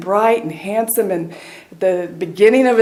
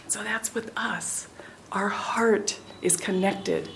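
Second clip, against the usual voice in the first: whisper; 10 dB quieter than usual. Real, not acted. Intimate spiritual point.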